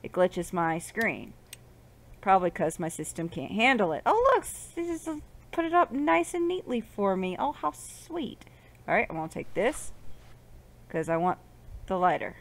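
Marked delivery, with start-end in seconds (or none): 4.1-8.4 high, sugary mock delight; mock gushing. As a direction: coo it high as if touched. Mock gushing over tidy items.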